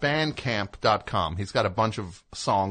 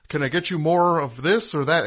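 overeager, higher-pitched server's offer; desperate business owner; 6 semitones higher, 4 dB louder than usual. Retold or imitated. Host mimics owner hovering over a customer, overeager and higher. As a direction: imitate an anxious new owner pushing service. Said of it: "that desperation of a new business owner".

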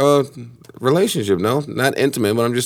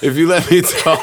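laughing; 4 semitones higher than usual. Real, not acted. Laughing protest.

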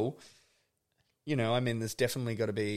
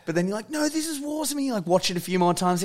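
much higher and louder, revved-up telling; animated; 10 semitones higher, 10 dB louder, faster than usual. Real, not acted. Telling a friend about a partner's critique, animated. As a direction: really wind up the story. Excited retelling, high loud.

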